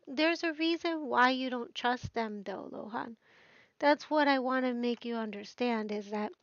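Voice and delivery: normal talking voice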